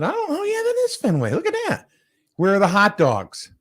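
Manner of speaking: very high-pitched gawking voice; mock amazement. Retold reaction to seeing the stadium.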